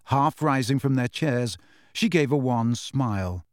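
ordinary delivery, nothing marked

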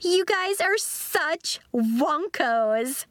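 high, drawn-out derision; cackling mockery. A narrator's reading. Lacey mocks Nori's band, cackling. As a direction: gloat slowly, stretching each insult. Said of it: "Lacey cackled".

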